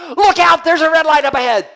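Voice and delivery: high, loud warning cry from the passenger; alarmed warning shout. Retold or imitated. Passenger warning the driver, loud alarmed shout. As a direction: shout it like danger is right ahead. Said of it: "That person in the front seat goes".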